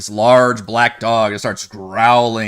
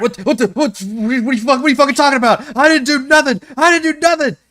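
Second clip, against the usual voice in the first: panicked denial; 4 dB louder, faster than usual. Acted. Accused NPC Wumpus protesting wildly.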